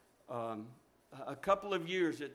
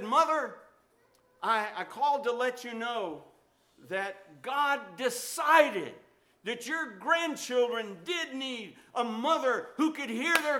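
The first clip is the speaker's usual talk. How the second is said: high, loud joyful announcement; triumphant son; 9 semitones higher, 9 dB louder than usual. Retold or imitated. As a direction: tell her the good news, overjoyed. Son phones mother with news.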